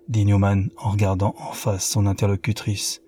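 ordinary delivery

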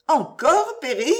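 high, loud, exasperated question; jealous outburst rising sharply at the end; indignant disbelief. A narrator's reading.

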